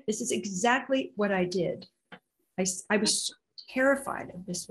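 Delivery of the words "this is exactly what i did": ordinary delivery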